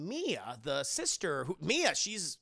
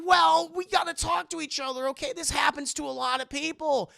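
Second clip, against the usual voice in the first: high, loud scolding; high lecturing voice; 9 semitones higher, 8 dB louder than usual. Retold or imitated. Wife scolding husband, high and loud. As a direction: lecture him like a child.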